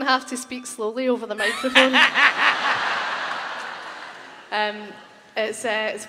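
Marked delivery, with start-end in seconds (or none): 2.0-4.1 a loud breathy burst of laughter. Real, not acted. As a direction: laugh out loud at your own joke. Laughs at own joke on stage.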